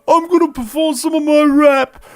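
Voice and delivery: sob-shout with delight over the gift; overjoyed crying. Acted. Overjoyed family over birthday gift.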